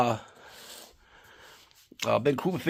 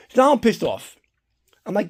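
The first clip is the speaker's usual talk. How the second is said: usual speaking voice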